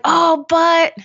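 high, loud pleading protest; out-of-alignment voice. Shown as a demonstration. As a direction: pitch it way up, making excuses. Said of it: "and then I get in this voice".

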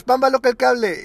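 loud high game call; shouting. Retold or imitated. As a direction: yell it like a schoolkid.

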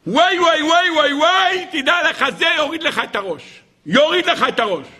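high-pitched, wailing warning; hammed-up cries of dread; acted alarm. Pharaoh's magicians warn him about the child, in high alarm.

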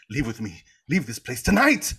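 spout it like a stage Shakespearean; mock Shakespearean lover. Lovestruck hero retold pleading with her to leave, mock Shakespearean. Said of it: "he's just spouting it like Shakespeare".

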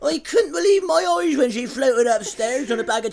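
hammed-up chatty storyteller; small-talk parody. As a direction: pile on the absurd news through laughter. Joins mock mundane chat, absurdly.

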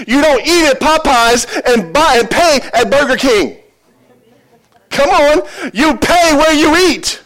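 loud, high preaching with a laughing start; full-force preaching. Acted. Pastor urging the congregation to tithe, loud preaching. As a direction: preach it out to the whole church, building and emphatic.

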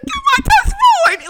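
very high, loud, drawn-out squeal; starstruck squeal. Retold or imitated. Fan tells Montez Ford who he is, squealing. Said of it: "Like, in that voice".

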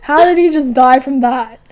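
loud disbelief. Retold or imitated. Retold disbelief at death.

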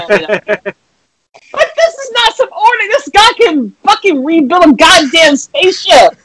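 high-pitched, worked-up outrage; incredulous rant. Retold or imitated. Yelling about the crying character's job, incredulous. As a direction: rant high, stretching the key words.

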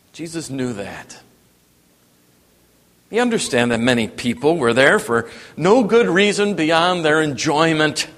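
ordinary talk, nothing marked

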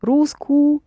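Русскую pitched high, stopping short of the last ю; unfinished ending. Shown as a demonstration. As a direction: leave the final ю of Русскую for the learner. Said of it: "Another you".

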